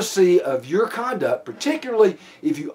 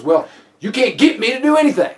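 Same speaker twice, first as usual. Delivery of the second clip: high, louder mock defiance; defiant retort; 8 semitones higher, 4 dB louder than usual. Suspect refusing officer's order, defiant retort. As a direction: fire back like a challenged suspect. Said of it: "the natural response to that is".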